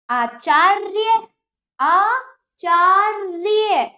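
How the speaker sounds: आचार्य very slow and loud, every syllable stretched; drawn-out syllables. Shown as a demonstration. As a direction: sound out आचार्य for pupils, holding the र before य. Teacher sounds out the word slowly.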